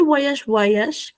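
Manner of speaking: "Voyage" as "vwa-yash" in French; French pronunciation. Shown as a demonstration. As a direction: model "Voyage" slowly for the class. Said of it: "que se pronuncia así".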